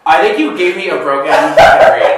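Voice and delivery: loud, laughing, exasperated; laughing exasperation. Real, not acted. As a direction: laugh it out in frustration. Frustrated laughing with reed.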